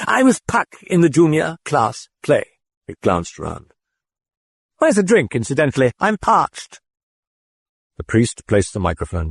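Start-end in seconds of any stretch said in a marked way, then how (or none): 0.1-6.8 cheeky British voice. A narrator's reading. The British voice ribbing the priest. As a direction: tease the padre in British.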